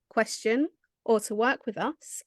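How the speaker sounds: ordinary talk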